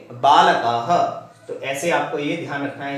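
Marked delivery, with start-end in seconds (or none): 0.1-1.2 "बालकः" said slowly, ending on a breathy ह; slow visarga demo. Shown as a demonstration. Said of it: "क्या बोलेंगे". (धीरे से "बालकः", अंत में हल्का ह)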